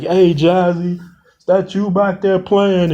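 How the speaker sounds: high, wary question; uneasy call. Acted. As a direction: ask nervously if it's a prank. Spotting someone in the dark.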